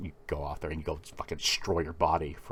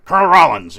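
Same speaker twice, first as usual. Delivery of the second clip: loud military address, pitched up; shouted salute; 9 semitones higher, 16 dB louder, faster than usual. Acted. Waking up to address the imagined colonel, shouted. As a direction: call it out like a recruit.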